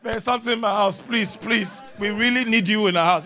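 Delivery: a hammed-up desperate member begging for help; pleading congregant. Member begs the prophet to come home, mock pleading.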